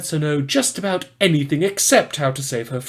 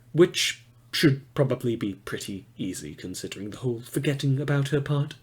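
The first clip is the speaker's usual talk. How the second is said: whispered aside; 2 semitones lower, 8 dB quieter than usual. Acted. Conspiratorial aside.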